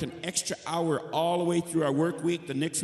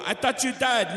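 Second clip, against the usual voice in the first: surprised; 10 semitones higher, 5 dB louder than usual. Retold or imitated. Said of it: "you go".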